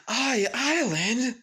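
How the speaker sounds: drawn-out, high, astonished question; can't believe what he just read; stunned disbelief. Acted. Izuku reading his dad's invitation, stunned and drawn out.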